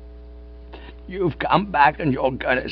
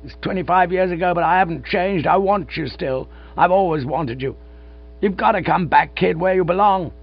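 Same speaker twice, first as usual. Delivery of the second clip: pleading cry; 7 semitones higher than usual. Acted. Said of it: "he cried".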